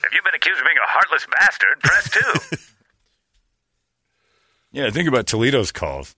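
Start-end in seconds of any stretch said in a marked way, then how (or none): 0.0-2.6 automated hotline voice, higher; phone menu voice. Acted. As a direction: read it like a recorded menu.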